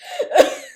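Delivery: high, cackling witch laugh; witch cackle. Real, not acted. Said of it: "I sound like a cackling witch".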